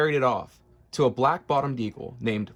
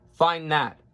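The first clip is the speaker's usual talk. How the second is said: higher, louder taunting sneer; 5 semitones higher, 4 dB louder than usual. A narrator's reading. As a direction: taunt the elephant. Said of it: "sneered the bird".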